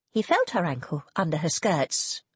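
ordinary delivery, nothing marked

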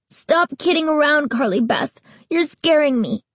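put-on high, pleading frightened boy; scared and angry. A narrator's reading. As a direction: protest through fear. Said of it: "His voice sounded angry, but his eyes revealed fear".

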